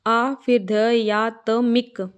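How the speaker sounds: slow, separated syllables; sound-by-sound breakdown. Shown as a demonstration. Teacher breaks the word for students, slow syllables. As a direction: spell it out slowly by ear. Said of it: "आ आएगा".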